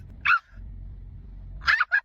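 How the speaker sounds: high breathy laugh of disbelief; disbelieving laugh. Real, not acted. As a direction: laugh at the absurdity. Reacting to ban letter.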